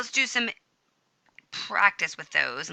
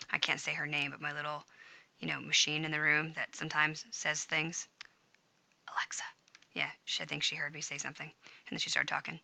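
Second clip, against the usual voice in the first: very soft, slightly lowered aside; hushed; 2 semitones lower, 10 dB quieter than usual. Real, not acted. Teacher avoids waking the machine in the room, hushed. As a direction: keep it down so the device won't hear.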